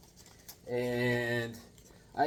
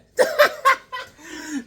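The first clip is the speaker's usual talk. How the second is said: high laugh; 13 semitones higher, 7 dB louder than usual. Real, not acted. Seeing the goofy character, a high laugh. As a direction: burst of high, loud laughter. Laughing at Goody Two Shoes.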